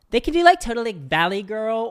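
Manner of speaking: high, exaggerated Valley Girl voice; Valley Girl accent. Shown as a demonstration. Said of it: "doing an American accent".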